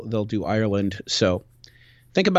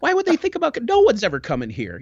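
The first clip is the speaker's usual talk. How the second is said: squeaky, much higher voice of an annoyed seal; indignant seals; 3 dB louder than usual. Acted. Seals in the cave complaining about the intruders, high and indignant. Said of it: "seals in there who were like".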